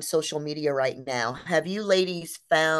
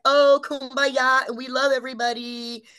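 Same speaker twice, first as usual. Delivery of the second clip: mock peace and love; 6 semitones higher, 4 dB louder than usual. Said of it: "it's like".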